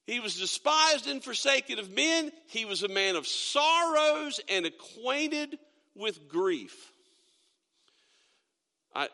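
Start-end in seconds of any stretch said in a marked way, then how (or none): 0.1-6.9 slow, measured Bible reading with weight on each phrase; scripture reading. Acted. Pastor reading Isaiah to the church, slow and solemn. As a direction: read the verse slowly to the congregation.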